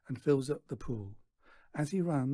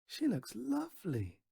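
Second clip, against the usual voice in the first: put-on much higher, gentle boy voice; admiring boy; 9 semitones higher than usual. A narrator's reading. Stan admiring the photograph, high gentle. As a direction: say it sincerely.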